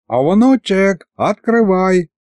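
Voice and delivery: drawn-out, high-pitched call through a door; grandpa calling. A narrator's reading. (дед протяжно зовёт через дверь)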